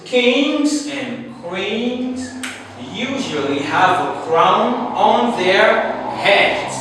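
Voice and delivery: very slow start, stretched "Kings"; slow example read. Shown as a demonstration. Teacher reading the second example slowly. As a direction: draw out the sentence for learners. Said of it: "the second one is".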